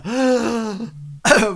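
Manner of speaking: loud shocked gasp; crowd gasp. Acted. Crowd hears the accusation of illegal trading, loud acted gasp. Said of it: "there's a sort of, like, a".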